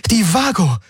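high, excited cry; sudden exclamation. A narrator's reading. Kirai hits on the ship as a way out, exclaiming. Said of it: "rief Kirai aus".

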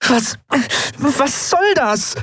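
very high, stammered indignation; indignant protest. Acted. Endriel shoved from the controls, indignant protest. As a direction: protest high-voiced, drawing out the question.

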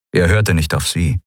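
ordinary delivery, nothing marked

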